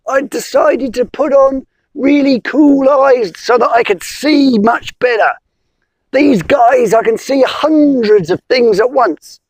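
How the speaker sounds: frog with headache. Acted. Frog complaining to the wizard, high and pained. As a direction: moan like a frog with a sore head.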